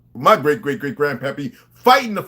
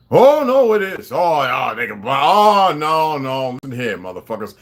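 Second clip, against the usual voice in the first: mocking gasp; 8 dB louder than usual. Mocking gullible believers.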